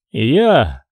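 a firm, short claim. A narrator's reading. Pimen claims the see, firm and short. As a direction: plant it flatly, no doubt. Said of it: "твёрдо ответил Пимен".

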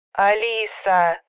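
long drawn-out moan on the phone; sister's slow, protesting telephone groan. A narrator's reading. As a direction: groan the name in exasperation. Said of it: "простонала Каринка".

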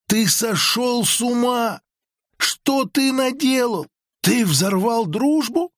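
высоко, протяжно, в ужасе; растягивай слова высоким потрясённым голосом; потрясённое обвинение. A narrator's reading. Потрясённая жена обвиняет мужа в истории. (в ужасе)

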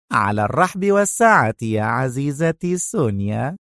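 swooping, mock-obliging reply. A narrator's reading. Rachel obliging Sonia, mockingly. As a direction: agree with a smirking lilt. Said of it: "ابتسمت راشيل في سخرية وهي تقول".

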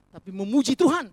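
"Tuhan" dipukul keras dan jatuh di akhir; tekanan pada Tuhan. Real, not acted. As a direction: tegaskan "Tuhan" dengan suara lantang. Contrast: singing vs truly praising. (tekan kata "Tuhan" dengan keras)

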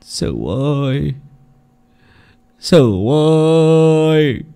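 very slow, drawn-out lament; anguished wail. Acted. Dying father lamenting to his son, very slow and anguished. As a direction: wail the son's name in despair.

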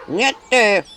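loud sulky comeback; sulky retort. Acted. Imp Antipka pouting back at Anchutka, mild retort. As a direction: snap back in a pout. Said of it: "насупился Антипка".

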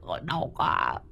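soft, worn-out groan of pain. A narrator's reading. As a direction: sag into the pain. Cậu rên đau. (cậu rên khẽ vì đau)

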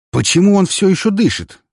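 loud, high furious question; angry demand. Acted. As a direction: storm in and demand it. Furious count storms in.

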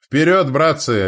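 loud high bark; barking command. A narrator's reading. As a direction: roar the charge to your men. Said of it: "рявкнул Варун".

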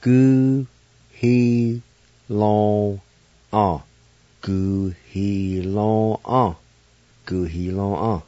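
Gu, he, long, ah said as separate syllables; teacher drilling syllables. Shown as a demonstration. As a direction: sound out Gu, he, long, ah. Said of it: "And this is something like washing a car".

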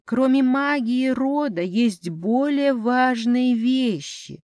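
ordinary delivery, nothing marked

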